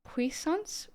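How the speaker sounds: puissance said the French way, pwee-SAHNS, rising at the end; French pronunciation. Shown as a demonstration. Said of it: "the French conception of".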